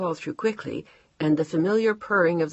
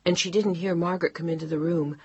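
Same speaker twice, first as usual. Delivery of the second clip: plain reading voice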